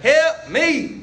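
high, whiny cry for help; mock plea. Pastor imitating himself calling friends for help, mock whiny plea. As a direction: beg friends for help, half-joking. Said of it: "several of you guys in here like".

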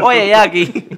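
high, loud mock Dominican call; Dominican woman. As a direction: shout it like a hammed-up Dominican woman. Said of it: "Si usted se metiera una dominicana".

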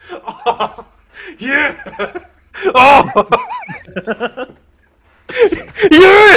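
laughing hammed-up moans; loud laughing mock moan. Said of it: "I just imagine".

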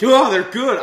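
loud, high acted delight; hammed-up happy outburst; delighted exclamation. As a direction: burst out pleased with the taste. Voicing delight at tasting the cookies.

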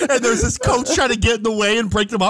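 talking through laughter, voice pitched high; can barely get it out for laughing. Real, not acted. Laughing while retelling the fight.

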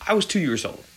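ordinary delivery

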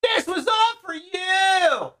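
high-pitched, strained mock shout to a child; over-earnest far-off yell; mock heroic shouting. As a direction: call it out high, imitating a showy dad. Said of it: "he was like".

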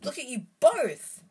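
loud, high alarmed cry; shrieking. A narrator's reading. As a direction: shriek it at the trapped boys. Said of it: "shrieked Hermione".

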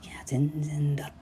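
soft unvoiced whisper. Real, not acted. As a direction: murmur it under your breath. Whispered reply.

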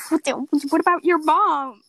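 incredulous. Retold or imitated. Narrator mocks movie ignoring mom.

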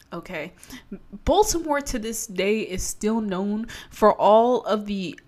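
ordinary talk, nothing marked

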